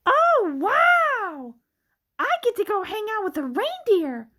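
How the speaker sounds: thrilled. Acted. Thrilled about meeting a reindeer.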